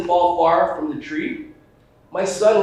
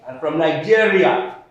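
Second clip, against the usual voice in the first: put-on Nigerian accent; 5 semitones lower, 4 dB louder than usual. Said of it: "Ask somebody from Africa".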